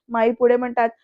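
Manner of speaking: plain reading voice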